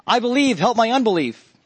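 high-pitched earnest plea, acted; heart cry. Father of possessed son pleads with the Lord, heart cry. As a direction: cry out for help, voice lifted high. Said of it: "this is the same heart cry".

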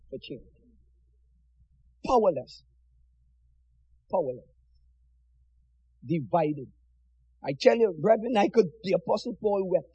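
low, soft, mournful preaching; sorrowful hush. Real, not acted. Preacher lamenting the scattered brethren, soft and mournful. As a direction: grieve over the words softly. Preacher weeping over divided church.

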